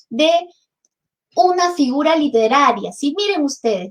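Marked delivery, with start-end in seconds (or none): none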